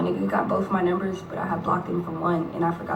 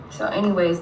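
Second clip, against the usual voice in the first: ordinary talk, nothing marked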